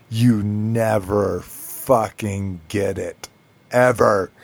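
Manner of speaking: slow, weighted words of retold exasperation; fed-up emphasis. Wife telling husband he misses her sarcasm, fed-up emphasis. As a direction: spell it out slowly, landing hard on the last word.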